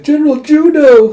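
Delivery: high, loud squealing shout of his name; fangirl squeal. Retold or imitated. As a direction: squeal it like a crowd of swooning fans. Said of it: "a bunch of women are squeaking at his name".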